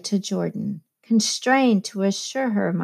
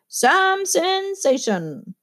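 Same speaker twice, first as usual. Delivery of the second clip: much higher, slow, drawn-out mock boast; jovial condescension; 11 semitones higher, much slower than usual. A narrator's reading. Bandleader joking to the guests with jovial condescension. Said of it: "with jovial condescension".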